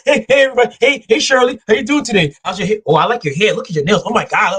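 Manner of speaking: high, gushing greeting; fawning coworker. Retold or imitated. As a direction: gush over everyone like a flatterer. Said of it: "they'll come to the room like".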